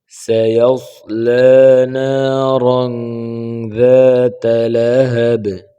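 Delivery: slow, melodic tajweed recitation; Quran recitation. Shown as a demonstration. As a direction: recite each phrase slowly with a long sung line. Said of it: "আমি একবার reading পড়ে যাচ্ছি".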